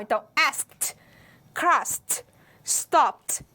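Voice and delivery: "asked", "crossed", "stopped" with the final t stretched out; exaggerated final t for the class; drawn-out endings. Shown as a demonstration. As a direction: pull the t at the end of each word. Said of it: "a teacher tá puxando pra você identificar".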